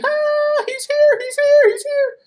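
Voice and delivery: very high, loud panicked shouting; boy fleeing to his mom; terrified cry. Acted. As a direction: scream it in a panic. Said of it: "he cried".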